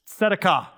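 tzedakah with a crisp TS at the start; Hebrew pronunciation. Shown as a demonstration. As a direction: model "tzedakah" clearly for learners, first syllable stressed. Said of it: "that is how you pronounce".

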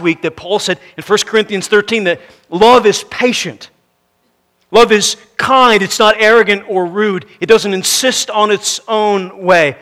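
high, loud preacher's challenge; forceful preaching. Real, not acted. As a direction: ring it out to the whole congregation.

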